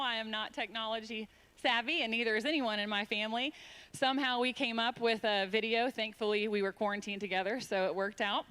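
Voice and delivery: usual speaking voice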